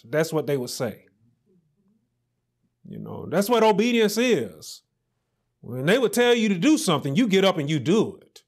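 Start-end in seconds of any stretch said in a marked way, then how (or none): none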